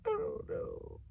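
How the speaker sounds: breathy trailing whisper. Real, not acted. Admitting regret about her past, barely whispered. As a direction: let it fade to a whisper.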